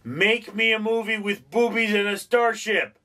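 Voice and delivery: high, pushy demand; demanding producer. Retold or imitated. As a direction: ask for it like a greedy producer. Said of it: "somebody said".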